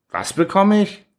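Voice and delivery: startled high-pitched question; a sudden, disbelieving yelp of a question; surprised. Acted. Patient shocked at plum jam. (überrascht, ungläubig hochfahrend)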